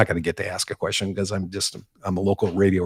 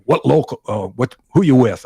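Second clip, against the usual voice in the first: louder, higher, gruff challenge; gruff demand; 4 semitones higher, 7 dB louder than usual. Retold or imitated. Labor boss interrogating the reporter, loud and gruff. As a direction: bark the question at the nobody. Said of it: "he goes".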